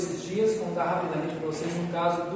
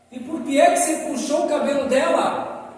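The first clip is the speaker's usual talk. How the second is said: higher, louder teacher asking why; a teacher questioning a boy, higher and louder; probing teacher; 6 semitones higher, 5 dB louder than usual. Retold or imitated. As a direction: press the child for the reason, lightly voiced.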